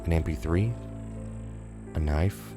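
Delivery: plain reading voice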